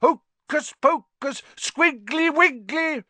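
high, loud, drawn-out mock spell; slow, fierce spell-chanting; fierce incantation. A narrator's reading. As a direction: cast nonsense magic words menacingly. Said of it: "said Harry in a fierce voice".

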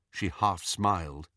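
ordinary delivery, nothing marked

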